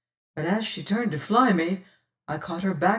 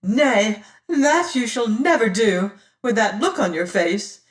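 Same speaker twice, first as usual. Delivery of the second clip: loud, high protest; crying out; 4 semitones higher, 6 dB louder than usual. Acted. Damaris cries out refusing Martin, loud and high. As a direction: cry out in defiance. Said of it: "cries she".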